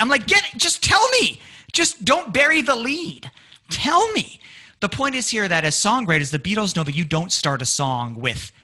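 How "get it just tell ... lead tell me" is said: high, insistent plea to hear it; impatient outburst. Acted. Impatient listener begs friend for news, high-pitched insistence. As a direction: demand it, pitch leaping up.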